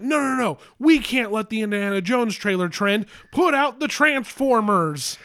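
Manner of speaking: high, urgent executive rant; mock frantic orders. Studio bosses deciding on trailer releases, mock frantic. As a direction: bark orders like a panicked studio boss.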